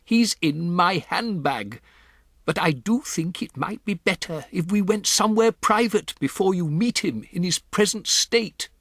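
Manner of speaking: put-on a composed old grandmother breaking odd news; grandmother's voice. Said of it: "my grandmother said".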